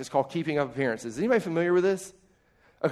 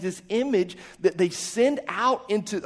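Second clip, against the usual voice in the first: normal talking voice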